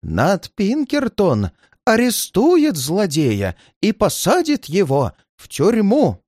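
high, slow, emphatic insistence; fixated old man, words drawn out; obsessive old man. A narrator's reading. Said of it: "опять пробормотал своё старик".

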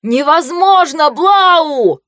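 high, hoarse yell into wind; shouting over wind. Acted. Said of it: "пытается перекричать ветер".

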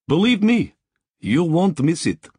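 a Russian worker greeting a VIP; Russian accent. A narrator's reading. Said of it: "Her accent was richly Russian".

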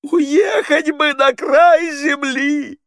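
very high, loud, slow wail; long drawn-out hammed-up lament; theatrical howling. A narrator's reading. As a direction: howl it like a tragic heroine. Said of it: "выла Полина".

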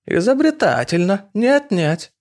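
put-on high teasing old lady voice; mocking old woman. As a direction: tease him like a brassy matron.

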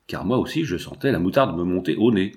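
ordinary delivery, nothing marked

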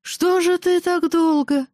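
put-on high, slow drunken whine; drunk tease. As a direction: whine it like a tipsy king.

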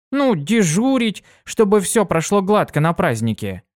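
высокий бойкий девичий голос; звонко и живо, как юная пегаска; бойкая пегаска. Acted. Дитси объясняет. (Дитси рассказывает другу, высоко и бойко)

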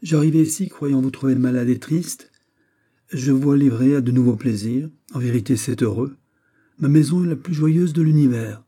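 plain reading voice

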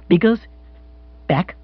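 stunned, halting, high-pitched question; dumbfounded and faltering; speech found with difficulty. Acted. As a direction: force the words out in shock. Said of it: "Bill found speech, though with difficulty".

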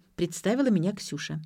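plain reading voice

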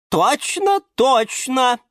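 very high, eager character voice; eager agreement. A narrator's reading. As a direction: chime in with keen agreement. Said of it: "закивала своими большими красными цветами". (высоко и охотно поддакивать)

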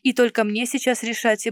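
ordinary delivery

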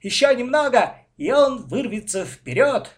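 loud, high, excited commentary; thrilled sports-reporter voice; race commentary. A narrator's reading. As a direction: call the race as it heats up. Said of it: "продолжал вести репортаж с регаты".

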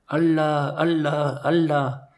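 drawn-out wailing call in another voice; sustained, chant-like mock cry. A narrator's reading. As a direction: call it out long, like a distant cry for help. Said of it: "une voix cria".

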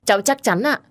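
emphatic, earnest assurance; firmly certain. A narrator's reading. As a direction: assert it without any doubt. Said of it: "gật đầu khẳng định chắc nịch".

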